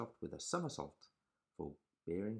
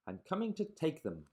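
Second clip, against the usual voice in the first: much higher and louder greedy yell; 10 semitones higher, 7 dB louder than usual. Acted. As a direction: shout it with greedy glee. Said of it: "the rat thief yelled".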